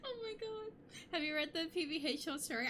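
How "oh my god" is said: laugh-crying. Real, not acted. After laughing at a story.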